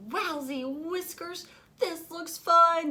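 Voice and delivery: high, slow, wide-eyed wonder; thrilled kitty voice; excited cat. A narrator's reading. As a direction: gasp it out in delight. Dewey excited.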